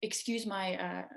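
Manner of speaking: ordinary talk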